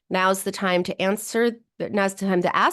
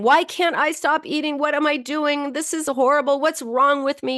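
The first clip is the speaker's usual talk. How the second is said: higher, pained self-scolding questions; self-recrimination; 6 semitones higher than usual. Retold or imitated. Binge eater scolding herself mid-binge, higher and pained. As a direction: berate yourself in the middle of a binge. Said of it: "just filled with self-recrimination".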